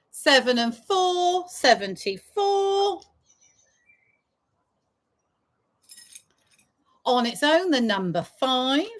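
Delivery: sing-song bingo number calls; drawn-out call then quick repeat; bingo caller. Acted.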